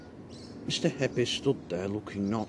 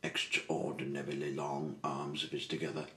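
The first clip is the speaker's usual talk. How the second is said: plain reading voice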